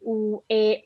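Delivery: normal talking voice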